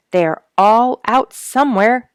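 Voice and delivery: high, loud call, out of breath; called down breathlessly. A narrator's reading. Duke calling down from the cedar, loud and breathless. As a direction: call down from up a tree, panting. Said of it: "he called down breathlessly".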